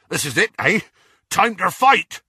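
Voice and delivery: excited, winded call rising high and loud; panting. A narrator's reading. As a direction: puff it out, eager for a fight. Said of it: "panted Hagrid".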